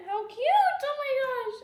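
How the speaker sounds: high loud squeal of delight; excited squeal. Real, not acted. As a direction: squeal at how cute it is. Squeal over toy.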